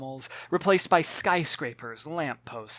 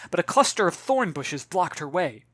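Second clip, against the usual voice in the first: ordinary delivery, nothing marked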